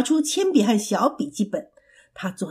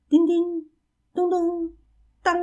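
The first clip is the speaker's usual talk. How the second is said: high, ringing imitation plucks; voiced banjo notes; 7 semitones higher than usual. A narrator's reading. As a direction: imitate a banjo, letting the last note ring. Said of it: "弹着小斑鸠琴". (拖着余音模仿琴声)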